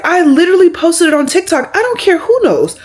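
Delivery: exasperated. Retold or imitated. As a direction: make it obvious, almost laughing in disbelief. Exasperated retort about public TikTok.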